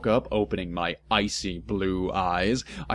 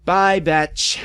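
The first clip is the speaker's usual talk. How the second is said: mock farewell; 7 semitones higher, 4 dB louder than usual. Mocking farewell.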